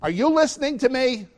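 family member cross at not being heard; annoyed family member. Retold or imitated. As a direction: snap at the daydreamer. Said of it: "you ever have that discussion".

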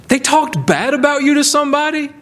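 high, ringing preacher's voice, pressing each phrase; full-force preaching. Acted. Preacher urging the congregation to grateful joy, ringing and fervent. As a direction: preach it out with fervour.

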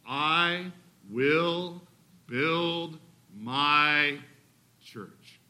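slow, low, solemn words of Jesus; measured solemn quote fading to a hush. Acted. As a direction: let it land as a settled promise. Preacher voices Jesus' promise.

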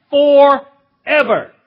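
"forever" loud and very drawn out, split in two; emphatic forever. Real, not acted. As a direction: stretch "for", pause, then land "ever". Preacher hammering home the verse.

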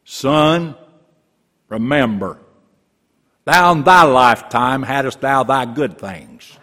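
slow, loud Southern drawl as Abraham; stately Southern-accented Abraham, slow and loud. Retold or imitated. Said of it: "They never knew Abraham had a southern accent".

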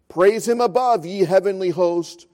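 ordinary talk

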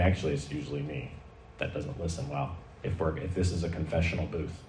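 low, very soft aside; hushed confession. Real, not acted. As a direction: drop right down as if confessing. Mock confession to the congregation.

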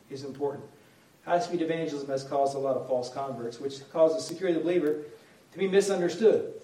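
normal talking voice

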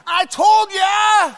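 high, loud, drawn-out shout; vindicated mock yell. Boy vindicated by the x-ray, hammed-up yell. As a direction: yell it as a kid proved right.

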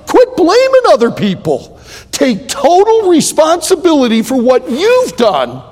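loud, driving exhortation; preaching at full force. Real, not acted. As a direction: press it home like a preacher.

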